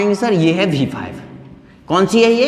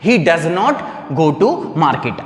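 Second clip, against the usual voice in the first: slow correct model; 3 semitones lower, much slower than usual. Shown as a demonstration. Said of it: "अब इस sentence को हम कैसे बोलेंगे बताओ".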